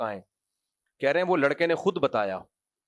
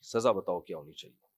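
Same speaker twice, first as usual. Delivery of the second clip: Zulaikha demanding softly; 10 semitones lower, 6 dB quieter than usual. Retold or imitated. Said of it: "وہ کہہ رہی".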